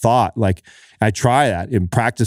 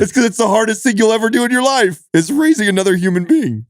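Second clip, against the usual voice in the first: high-pitched, fervent insistence; real impassioned outburst; 10 semitones higher than usual. Real, not acted. New father explaining why parenthood gives purpose, impassioned. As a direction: drive the point home with mounting conviction.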